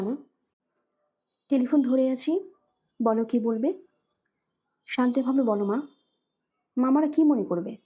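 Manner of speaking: usual speaking voice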